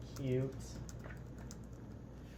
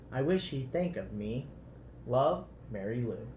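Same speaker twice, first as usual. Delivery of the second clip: lively, expressive storybook read-aloud; reading to children; 2 semitones higher, 3 dB louder than usual. A narrator's reading. Parent reading a picture book to children, lively storybook voice.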